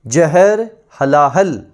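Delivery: very slow, each word drawn out; slow drill. Shown as a demonstration. Teacher slowing the words so students memorise them. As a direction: spell out "जहर हलाहल" slowly for memorising. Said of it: "विष को बोलते हैं".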